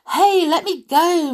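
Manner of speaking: high, loud cry for help. Acted. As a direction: cry out in panic. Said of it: "Mowgli cried".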